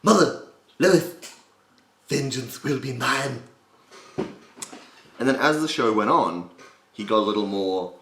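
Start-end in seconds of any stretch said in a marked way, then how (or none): 0.0-3.4 very British cartoon villain, raised pitch; commanding British character voice, slow at the end; Stewie Griffin. Shown as a demonstration. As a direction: declaim it high and British, loud on the calls. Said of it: "early Stewie is very British".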